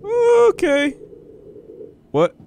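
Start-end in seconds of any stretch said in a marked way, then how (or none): 0.0-1.0 startled. Real, not acted. Startled by game.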